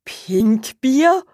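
high, loud disbelieving question; surprised witch. Acted. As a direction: toss the name back, baffled. Witch baffled by odd name.

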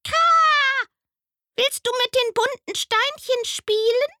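sehr hoch und laut, mit Krächzer vorneweg; krächzender Rabe. Acted. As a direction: ruf wie ein aufgeregter Rabe. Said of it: "und fragte". (hohe, laute Rabenstimme mit Krah)

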